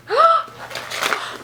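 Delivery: high, airy gasp of surprise; breathless gasp. Real, not acted. Vlogger unwrapping a book, gasping breathlessly. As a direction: catch your breath, amazed.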